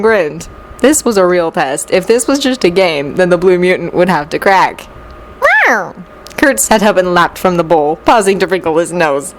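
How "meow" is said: very high, falling meow; cat meow. A narrator's reading. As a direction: cry out like an eager cat. Kurt answers as a cat.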